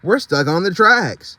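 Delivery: shouting. Acted. Driver orders animals off the tracks, urgent shout. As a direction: hurry everyone off the bus. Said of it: "he shouted".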